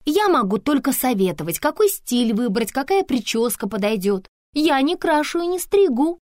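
high, loud, deliberate explanation; self-important Antonina, emphatic. Acted. Antonina explaining what an image-maker does, high and self-important.

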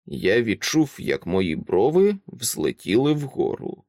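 ordinary delivery, nothing marked

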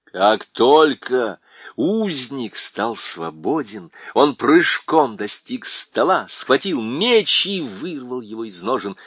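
ordinary delivery

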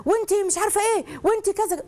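high, sharp mock scolding of a wife; playing the bossy husband snapping at her. A husband yelling down at his new wife, high and scolding. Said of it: "ويزعلها ويشخط فيها".

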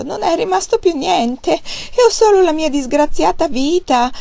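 high, loud whining plea; whimpering plea. A narrator's reading. Fat man whining to Wang Lung that nothing is left. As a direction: whimper on the edge of tears. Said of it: "disse fregnando".

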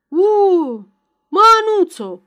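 loud call across the field. A narrator's reading. Ion calling out to Ana coming up the path, loud. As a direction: shout her name from far off. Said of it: "strigă Ion".

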